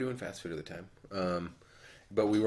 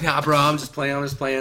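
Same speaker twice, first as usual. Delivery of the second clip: louder, raised, joking backpedal; retold joking reassurance; 6 semitones higher, 10 dB louder than usual. Customer backpedals to cashier kid, mock hasty. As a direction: brush it off loudly as a joke.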